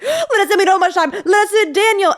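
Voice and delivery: urgent pleading. Retold or imitated. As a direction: beg to be let in, half laughing. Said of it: "They're like".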